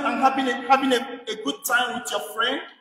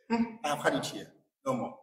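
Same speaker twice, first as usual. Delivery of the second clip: quiet fed-up aside; 9 semitones lower, 10 dB quieter than usual. Retold or imitated. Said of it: "And you could say".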